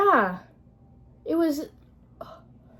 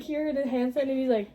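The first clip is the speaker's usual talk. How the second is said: ordinary talk, nothing marked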